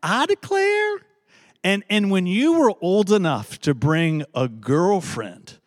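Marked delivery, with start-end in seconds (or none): 0.0-1.0 very high "I declare" run together as one word; Southern drawl, one word. Retold or imitated. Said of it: "all one word if you're from the South".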